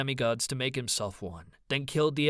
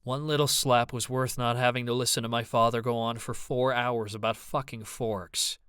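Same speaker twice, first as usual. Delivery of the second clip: ordinary delivery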